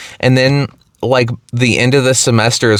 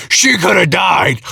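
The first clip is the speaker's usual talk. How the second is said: mock husband yell; 5 semitones higher than usual. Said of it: "yelling at the fighters".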